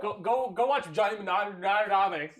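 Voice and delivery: loud, high, "Mnemonic" garbled with extra syllables; tongue-tied attempt. Real, not acted. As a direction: stretch "Mnemonic" into "muh-NAH-nuh-NAH-mik", laughing. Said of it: "you want to try and say it".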